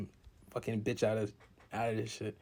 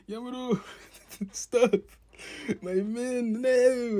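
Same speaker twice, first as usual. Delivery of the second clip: pitched way up and loud, begging Yamato to stop; squeaky, louder anime cry; high pleading villain; 13 semitones higher, 7 dB louder, slower than usual. Retold or imitated. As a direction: cry out high for your men. Villain voiced in anime recap.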